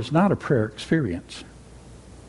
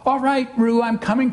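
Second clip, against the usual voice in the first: higher calling voice; 8 semitones higher than usual. A narrator's reading. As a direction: call across the water. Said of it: "called Christopher Robin".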